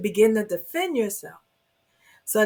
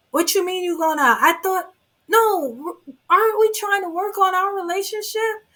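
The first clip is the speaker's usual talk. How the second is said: guilt-tripping partner; 8 semitones higher than usual. Retold or imitated. Abuser voiced guilt-tripping partner.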